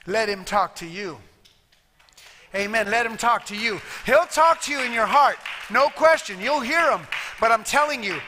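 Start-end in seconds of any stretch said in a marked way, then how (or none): 2.9-7.1 fervent loud preaching; preaching at full force. Real, not acted. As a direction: preach it out with fire. Preaching builds to shout.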